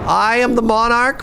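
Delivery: high, grand villain announcement; supervillain voice. Acted. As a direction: proclaim it like a cartoon villain. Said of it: "I have to be like, you know, like".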